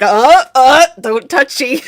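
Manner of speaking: loud, playful warding-off; mock refusal. Game master imagines the player rejecting a hug, mock refusal. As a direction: fend off a touch with loud, jokey little protests. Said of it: "if you were like".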